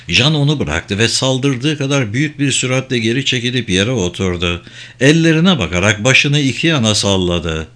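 usual speaking voice

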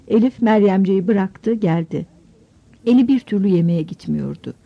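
ordinary delivery, nothing marked

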